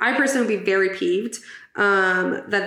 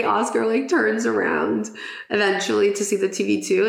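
usual speaking voice

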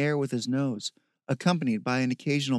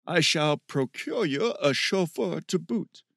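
slow, higher, hammed-up formal voice; mock-grand gentleman; 4 semitones higher than usual. A narrator's reading. Steve grandly promising Bear a driver, mock-grand gentleman. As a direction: promise it with slow, lordly pomp.

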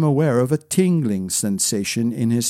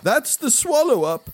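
high-pitched retainer's warning; blurted warning; 10 semitones higher than usual. A narrator's reading. Retainer warning the prince of the bog, high blurt. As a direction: warn him, pitched well up. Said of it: "blurted Kawa".

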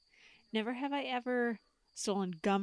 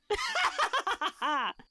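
evil cackle; 12 semitones higher than usual. Pretend. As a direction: cackle like a campy horror villain. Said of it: "tale of terror".

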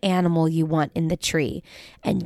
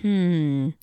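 ordinary talk, nothing marked

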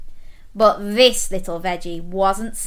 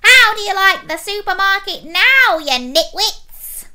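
high, loud gloating taunt; squeaky, wicked mockery; squealing evil pea; 9 semitones higher, 8 dB louder than usual. A narrator's reading. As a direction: jeer at everyone in villainous triumph. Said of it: "squealed the evil pea".

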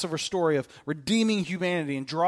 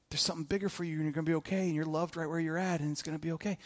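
softer, gentle reassurance; comforting a scared child, softer; soothing; 7 dB quieter, faster than usual. Pretend. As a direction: ease the listener like a comforted child.

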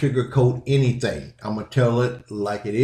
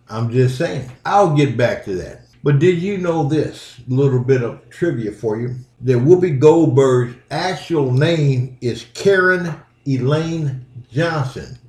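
ordinary delivery

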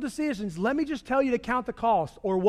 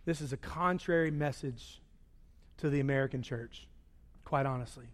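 hushed; 7 semitones lower, 9 dB quieter than usual. Acted. Hushed emphasis.

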